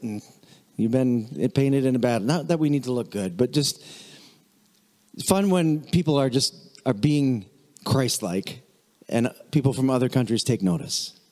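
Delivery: ordinary talk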